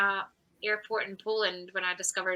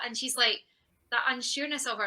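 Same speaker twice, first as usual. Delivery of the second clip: ordinary talk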